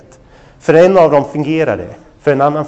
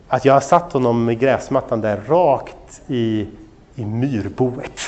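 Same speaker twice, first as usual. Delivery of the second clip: slow, soft, low punchline reveal; sheepish storyteller slowing to a hush; hushed confession; 5 semitones lower, 7 dB quieter, slower than usual. Retold or imitated. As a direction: drop low and drag out the dreadful admission. Slow reveal of his horrible mistake.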